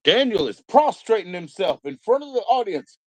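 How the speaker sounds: high, hammed-up begging for forgiveness; mock penitent plea. Mocking Daniel begging the audience to purge him, high and pleading. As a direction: beg like a sinner before a crowd. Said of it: "Daniel is an admitted sinner".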